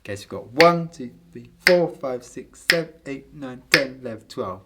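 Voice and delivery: counting twelve eight in groups of three; accented compound count. Shown as a demonstration. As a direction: punch the start of each group of three. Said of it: "four compound beats".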